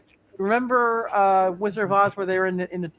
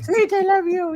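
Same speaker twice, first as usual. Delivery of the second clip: high-pitched swooning imitation; put-on high, gushing declaration; mock love cry; 12 semitones higher, 3 dB louder than usual. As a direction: echo a lovesick cry in a high voice. Game master echoes the player's acted cry.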